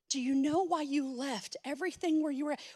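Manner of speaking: usual speaking voice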